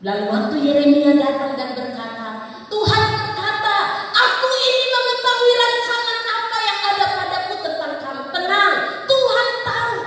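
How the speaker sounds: high, loud sermon cry; impassioned preaching. Real, not acted. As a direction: pile up each hardship at full force. Preaching at full force.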